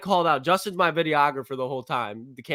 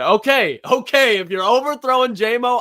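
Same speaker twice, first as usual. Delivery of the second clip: exasperated okay; 5 semitones higher, 6 dB louder than usual. Retold or imitated. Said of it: "I was like".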